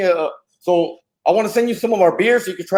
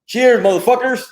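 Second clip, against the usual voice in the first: excited toast; 7 semitones higher, 4 dB louder than usual. Real, not acted. Toast before the chug.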